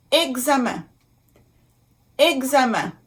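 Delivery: "examen" in French, ending in a nasal vowel; careful French pronunciation. Shown as a demonstration. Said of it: "it will sound like un".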